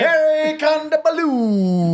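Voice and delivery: high, slow, hammed-up stage introduction; bullshit announcer accent. Shown as a demonstration. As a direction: draw out Kondabalu in a fake announcer accent. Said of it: "doing a bullshit accent".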